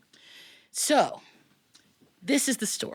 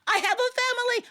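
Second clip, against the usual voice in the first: desperate plea. Acted. Brahmin begs for his life.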